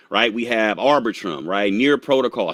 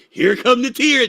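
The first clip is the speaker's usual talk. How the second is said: high, slow, teasing singsong; mock teasing friend; 11 semitones higher, 5 dB louder, slower than usual. Streamer imitating Abel teasing him about crying, high and slow. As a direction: draw out the tease like a friend waiting for it. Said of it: "Abel waits for me to cry just so he can say".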